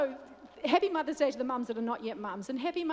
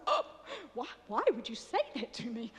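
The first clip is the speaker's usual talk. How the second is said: mock dismay. Wife to husband suggesting a child, mock dismayed. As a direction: play up being appalled, half laughing.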